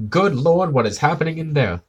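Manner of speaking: high, loud startled boss; alarmed Chalmers quote. Retold or imitated. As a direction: blurt it out like a shocked superintendent. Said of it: "and then he says".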